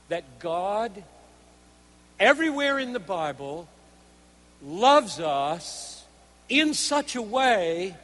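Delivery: loud, measured sermon delivery; emphatic preaching. Real, not acted. Preacher laying out God's design in loving us, emphatic preaching. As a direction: drive the point home word by word. Preaches his main point.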